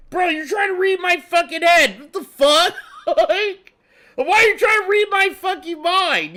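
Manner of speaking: high, loud indignant yelling; mock furious shout. Streamer acting an NPC catching Phil reading minds, mock furious shout. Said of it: "you catch him doing it".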